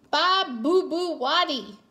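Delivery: put-on high, babbling toddler voice; sing-song toddler telling-off; baby talk. A narrator's reading. Baby Louie imitates the scolding.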